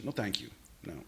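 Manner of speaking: usual speaking voice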